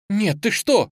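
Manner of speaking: high-pitched indignant protest. A narrator's reading. Girl protesting at a crude answer, indignant. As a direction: object as if appalled. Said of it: "возмутилась Настя".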